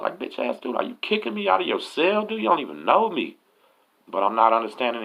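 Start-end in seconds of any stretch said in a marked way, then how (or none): 0.2-3.4 high-pitched indignant rant, acted out; mock-outraged inner protest; retold inner anger. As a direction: replay being mad inside, voice jumping up. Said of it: "I'm really mad as fuck".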